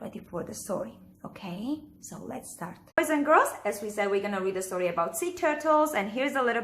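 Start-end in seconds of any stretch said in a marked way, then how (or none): 3.0-3.5 put-on overbright kids party host. Calling children's attention.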